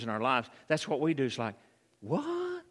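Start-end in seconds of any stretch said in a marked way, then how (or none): 2.0-2.6 high-pitched, softer mock disbelief; astonished. Preacher mimicking us seeing God do something, astonished. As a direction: squeak it in amazement. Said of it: "they were astonished".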